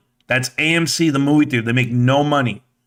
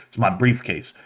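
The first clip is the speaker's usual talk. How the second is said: low flat bum voice; 7 semitones lower, 4 dB quieter than usual. Retold or imitated. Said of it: "He's like".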